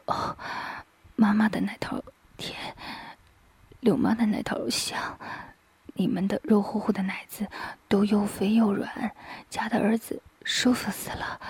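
softly murmured son's part, lightly slurred; indistinct murmur. A narrator's reading. Son answers his mother, indistinct half-whisper. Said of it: "于飞含糊不清地说".

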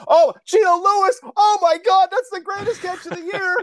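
screaming play-by-play thrill; over-excited play call. Shown as a demonstration. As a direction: lose yourself in a great catch. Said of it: "force myself to come back down".

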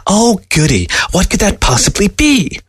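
high, sarcastic fake glee; mock delight. A narrator's reading. Fox mocking the old man's special gift with high sarcasm. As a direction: pretend to be thrilled, dripping sarcasm. Fox mocks Newberg's offer.

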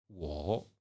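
"wo" drawn out slowly, ending in a rise; second-tone demo. Shown as a demonstration. Teacher showing the second Mandarin tone, "wo" rising. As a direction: let "wo" climb at the end. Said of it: "le premier ton".

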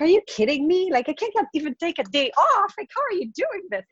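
high, disbelieving mock outburst; incredulous. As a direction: act stunned that anyone gets time off. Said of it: "And I'm like".

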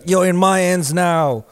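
slow, high, loud East London taunt; East London roadman accent. Retold or imitated. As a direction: imitate a rough East London roadman calling out. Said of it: "he starts talking like that".

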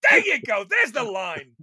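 loud high cheer; shouting congratulations; excited shout. Real, not acted. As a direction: yell it like a quiz host. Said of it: "cheering the listener's guess".

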